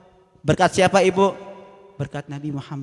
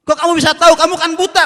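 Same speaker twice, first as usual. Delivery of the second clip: higher and louder, astonished; surprised question; 11 semitones higher, 11 dB louder than usual. Acted. Abu Bakar astonished the blind man knows, loud surprise. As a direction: blurt it out in disbelief. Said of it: "kata Sayyidina Abu Bakar".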